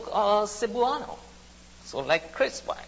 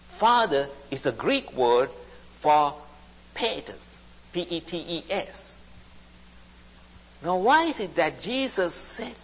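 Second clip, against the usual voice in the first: normal talking voice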